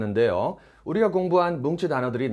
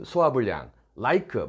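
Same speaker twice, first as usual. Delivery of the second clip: normal talking voice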